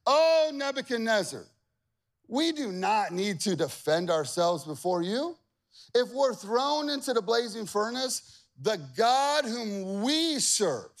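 sweet defiant sarcasm; sarcastic. Acted. Three men defying the king with sarcasm. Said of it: "This is a sarcastic moment".

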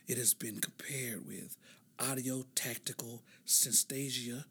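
whisper. Pretend. Whispers the ASMR definition.

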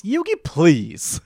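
mock-arrogant put-down; dismissive Kaiba. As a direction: brush him off with a drawn-out sneer. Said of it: "To which Kaiba says".

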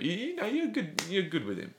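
a lightly voiced pleading dad, pitched high; half-acted high plea to hand off the job. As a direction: coax him gently in a high voice. Dad urging Uncle Phil, retold.

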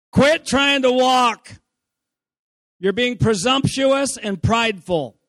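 loud, slow scolding parent voice. Retold or imitated. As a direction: lecture the toddler sternly. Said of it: "here's what, what the parent will say to the toddler".

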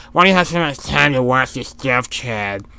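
put-on voice mimicking cable-TV critics; mock the people who judge your TV time; mocking naysayer voice. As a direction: imitate an annoying nag. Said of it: "That's how they sound".

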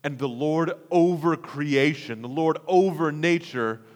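usual speaking voice

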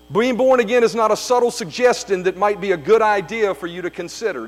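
usual speaking voice

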